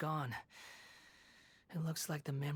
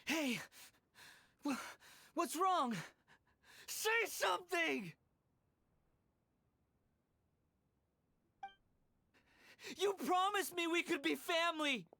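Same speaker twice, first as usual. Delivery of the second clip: high, frantic cry; desperate high calls to a lifeless friend; panicked shouting; 14 semitones higher than usual. Acted. Boy finds friend dead.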